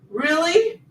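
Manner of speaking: high, incredulous really; genuinely taken aback; disbelieving question to chat; surprised. Real, not acted. Reading a surprising comment.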